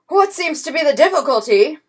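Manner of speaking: pompous, loud bluster. A narrator's reading. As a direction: puff up like an offended general. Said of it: "blustered the Count".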